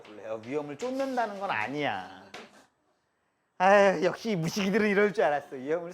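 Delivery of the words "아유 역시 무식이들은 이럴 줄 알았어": mock scoff. Mocking an imagined know-it-all.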